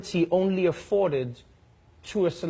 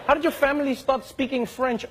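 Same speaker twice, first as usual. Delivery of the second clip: high mock bafflement, rising; lightly acted disbelief; mock puzzled question; 9 semitones higher than usual. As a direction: ask it like a baffled tourist. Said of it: "you're like".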